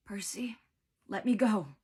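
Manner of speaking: strained, croaky plea; weak croak. A narrator's reading. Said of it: "she croaked".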